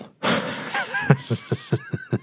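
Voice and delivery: airy, breathy chuckle; soft unvoiced giggle; whispery laugh. Real, not acted. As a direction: laugh under the breath at the joke. Laughs at own inside joke.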